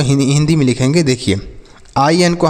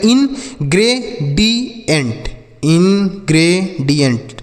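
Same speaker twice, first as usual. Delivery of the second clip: slow model reading; much slower than usual. Shown as a demonstration. Teacher to pupils, showing the reading, slow. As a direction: stretch ingredient for the class to copy. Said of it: "क्या लिखा गया".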